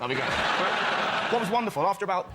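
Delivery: ordinary delivery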